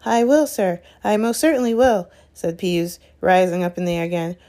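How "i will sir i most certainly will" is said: high, eager-to-please assent; fawning, higher-pitched agreement; servile. Acted. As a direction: promise obediently, grovelling to a master. Peeves grovelling to the supposed Baron.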